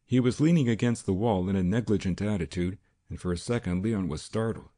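ordinary delivery, nothing marked

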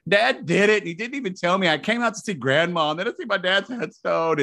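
high-pitched mock wailing complaint; imitating crying brother. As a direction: play the upset brother pouring it out tearfully. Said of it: "he calls me and he's crying".

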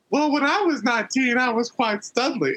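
high-pitched character voice, boasting; high, preening impersonation; Paul Bearer. Retold or imitated. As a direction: put on a high voice for a mock brag. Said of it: "Paul Bearer was a studly".

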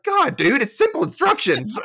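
high, loud exasperated squeal. Retold or imitated. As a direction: squeal it out in disbelief. Host voicing own frustration.

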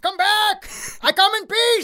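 calling out. Acted. Dak calling after the fleeing guys. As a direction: yell after them that you mean no harm. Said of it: "and he's like".

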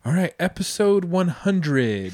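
put-on high, loud, slow kid announcer; little boy podcast host. Dad imitating his son grabbing the mic, little-kid announcer. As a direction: imitate a child proudly hosting a show. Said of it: "my little guy comes out here".

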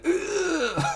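high, strained, throat-tight grunt; tense neck voice. Retold or imitated. Reviewer mocking Gibson without the accent, tight high grunt. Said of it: "without putting the Liverpudlian twang".

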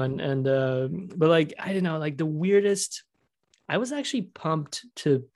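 ordinary talk, nothing marked

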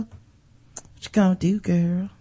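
a hint of a menacing sneer; mock taunt. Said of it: "I'll give my impression of it".

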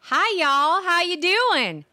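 high, bright Texan twang over hi y'all; Texas drawl. Pretend. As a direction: greet them like a friendly Texan gal. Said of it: "It's from Texas".

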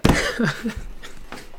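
high unvoiced laugh breaking the reading; a helpless burst of laughter; breathless laugh. Real, not acted. Reader cracking up mid-chapter, breathless laugh.

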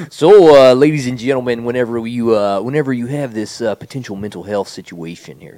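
loud, high presenter opening; mock announcer. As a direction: address the crowd like an emcee. Mock announcer address to audience.

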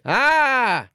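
a high, shrill-edged screech of rage; devil's screech. Acted. The tricked devil screeches at the old woman. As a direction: let out a high furious screech. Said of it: "screeched the devil".

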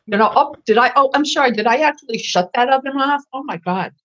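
high, sing-song innocent voice; fake apology. To the chef whose stock pot she shut off, high mock innocence. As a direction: play the faux-innocent saboteur, sweetly. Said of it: "And I was notorious".

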